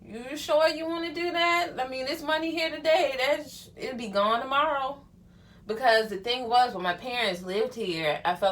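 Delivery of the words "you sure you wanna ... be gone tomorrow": high, pointed questioning of a spender; a higher, doubtful caution; skeptical warning. Retold or imitated. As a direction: raise your voice and side-eye the splurge. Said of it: "it's just like".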